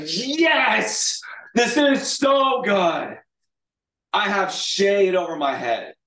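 high, loud, drawn-out cheer; hammed-up overjoyed shout; exceedingly happy. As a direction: exult at the top of your voice. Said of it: "he's exceedingly happy".

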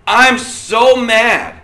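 drawn-out, high, loud outburst; acted anger. Preacher shows how mad people get, angry. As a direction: act out being mad, stretching it slowly. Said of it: "when they get mad".

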